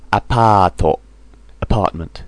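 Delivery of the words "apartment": ordinary talk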